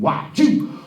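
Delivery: loud, abrupt tic-like outburst; involuntary blurt. Acted. Coworker listing skills at job interview, sudden loud blurt. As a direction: burst out with it after a calm list. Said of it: "he just blurted it out".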